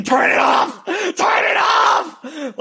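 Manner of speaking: imitating a desperate movie scream; screaming imitation. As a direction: scream it like a tortured film father. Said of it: "George C. Scott's screaming".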